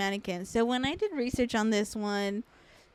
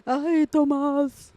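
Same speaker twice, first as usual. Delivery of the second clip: slow wailing cry, higher and louder; weeping ghost woman; 5 semitones higher, 4 dB louder, much slower than usual. Acted. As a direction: wail the names like a ghost.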